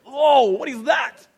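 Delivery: slow, loud, high-pitched disgust at filthy feet; disgusted podiatrist. Pretend. Podiatrist inspecting a filthy ancient foot, loud slow disgust. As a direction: recoil at a foul foot as a podiatrist.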